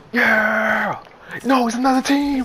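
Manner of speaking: high, loud excited yell; yelling the alarm to a teammate; excited shout. Real, not acted. Excited shouting mid firefight.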